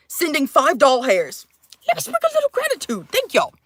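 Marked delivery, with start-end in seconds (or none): 1.9-3.5 very high, quick little squeak; playful gratitude. Pretend. Streamer thanking viewers for five bucks, squeaky and playful. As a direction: pipe it up in a tiny, hurried voice.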